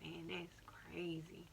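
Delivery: hushed, slow whisper. Retold or imitated. The other driver, stunned by the crash, whispering. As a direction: breathe it out slowly under your breath.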